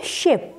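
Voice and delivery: शिप with a clear sh, शि not सि; शिप said with the श sound. Shown as a demonstration. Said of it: "क्या लिखा है ये?". (श वाली आवाज़ में शिप)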